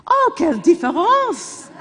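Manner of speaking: mock-gushing French exclamation, swooping high; delighted French reaction. French local learns the visitor is Scottish, mock delight. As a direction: imitate a French local suddenly thrilled, pitch leaping up.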